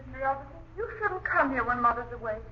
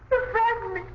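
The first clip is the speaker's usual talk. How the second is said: higher, louder fearful cry; frightened; 6 semitones higher, 4 dB louder than usual. Acted. Girl tells the gardener he scares her. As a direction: pull back in alarm.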